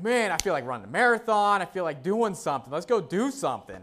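loud, high burst of energy; like you could run a marathon; pumped. Retold or imitated. Someone just recovered, bursting with loud excitement. Energized after getting well.

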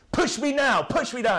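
put-on high, loud demanding kid; imitating a bratty kid yelling push me now; mock child shouting. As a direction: bark it like a spoilt child. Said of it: "that's literally how he shouted".

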